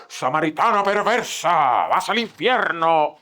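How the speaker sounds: slow, harsh preacher's condemnation; a hammed-up judgmental preacher; condemning the Samaritan woman, slow and harsh; mock condemnation. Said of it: "No, empezó".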